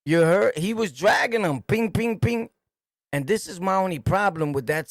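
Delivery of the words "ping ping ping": imitated gunshots.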